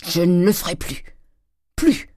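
emphatic promise, leaping high on plus; crying out. A narrator's reading. Husband promising his wife to stop, crying out. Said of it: "cria le tonnelier".

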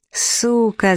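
understated yell of rage; mild narrated yell. A narrator's reading. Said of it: "заорал Клэг".

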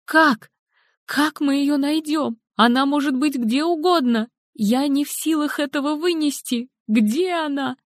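high, desperate grieving woman; distraught mother. Acted. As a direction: voice her high and near breaking with grief. Helen frantic about daughter.

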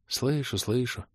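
soft, resigned sigh of a mother. A narrator's reading. Mother concedes to her son, softly sighing. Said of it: "вздохнула мама".

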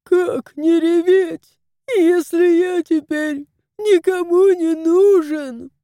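high, loud tearful wail. Acted. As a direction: wail it out in self-pity. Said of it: "Чего ревёшь?".

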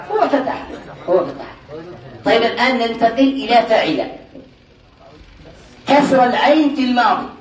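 normal talking voice

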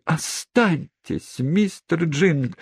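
high, very slow insistent demand; energetic demand. A narrator's reading. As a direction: command him to stay. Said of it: "энергически потребовала незамужняя тётушка". (энергичное требование)

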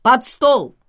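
sharp urgent shout to hide; shouted command. Acted. Thomas orders the girls under the table, sharp shout. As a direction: bark it out, push them under the table.

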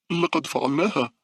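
high-pitched joyful cry; happy. A narrator's reading. Girl safe aboard tells her friend, happy and high. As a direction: exclaim it with delight, pitch way up. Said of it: "قالت مونيكا بسعادة".

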